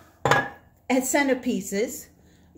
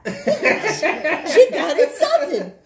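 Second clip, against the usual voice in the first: laughing; 5 semitones higher, 6 dB louder, much slower than usual. Real, not acted. Laughing retelling.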